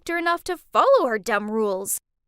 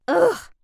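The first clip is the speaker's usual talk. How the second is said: loud, high ugh of annoyance; exasperated groan; 6 semitones higher, 7 dB louder than usual. Acted. Teen fed up with mom's control, exasperated groan.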